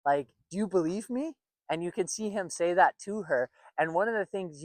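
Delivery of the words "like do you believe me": mock approval-seeking question, rising at the end; lightly acted needy question; validation-seeking questioner. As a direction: imitate someone checking for validation, voice lifting into the question. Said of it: "you start asking questions like".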